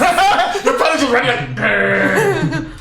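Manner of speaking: playful growled grr; panther growl. Acted. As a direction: growl like a big cat. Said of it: "That's my panther growl now".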